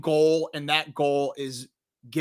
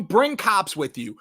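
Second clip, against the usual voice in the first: ordinary delivery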